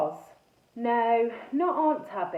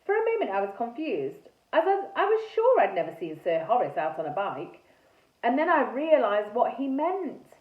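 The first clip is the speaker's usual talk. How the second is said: plain reading voice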